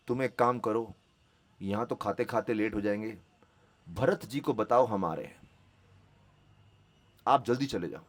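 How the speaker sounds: low, softened instruction, as if taken into a corner; quiet aside. Retold or imitated. Ram taking Hanuman aside to send him ahead, quiet aside. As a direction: confide the errand softly, voice dropped low.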